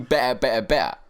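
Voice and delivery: "ba, ba, ba" as loud identical bursts; mocking, loud repeated "ba, ba, ba"; mimicked B sounds. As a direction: punch out each "ba" as a bare B syllable. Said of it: "It's just going".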